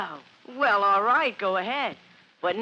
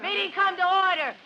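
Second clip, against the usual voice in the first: high, raised chairman's call over the noise; calling to order; 8 semitones higher than usual. Acted. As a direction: shout the room back to order. Chairman calling noisy meeting to order.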